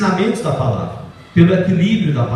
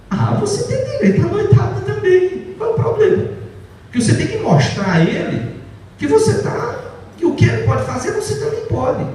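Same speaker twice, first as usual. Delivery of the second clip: mock-cheery, much higher, with a laugh; mocking advice; 13 semitones higher than usual. Preacher ridiculing counsel that wives deserve nights out too. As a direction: parrot the silly advice in a sarcastic lilt. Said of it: "o conselho agora é".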